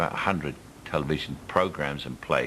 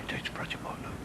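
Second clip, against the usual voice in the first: whisper; 12 dB quieter, faster than usual. Retold or imitated. Pointing out a famous man.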